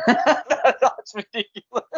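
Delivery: high, slow, laughing mimicry of a friend; mocking echo. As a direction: parrot him back in a squeaky, laughing voice. Mocking co-host's flat reply.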